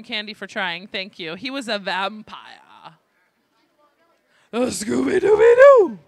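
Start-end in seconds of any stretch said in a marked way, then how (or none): 4.5-6.0 loud cartoon-dog howl; Scooby-Doo. Host capping a Scooby-Doo trivia question, loud cartoon impression. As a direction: belt out a cartoon catchphrase. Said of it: "I just wanted to do that".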